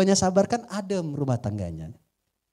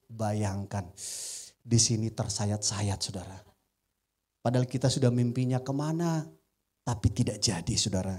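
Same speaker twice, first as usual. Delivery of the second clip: hurt and low; 10 semitones lower, 6 dB quieter than usual. Real, not acted. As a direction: lower and softer, heavy with disappointment. Retelling losing his commission.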